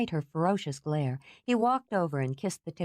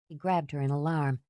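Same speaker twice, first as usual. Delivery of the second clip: ordinary delivery, nothing marked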